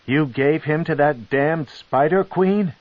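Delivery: incredulous, building anger; outraged. Acted.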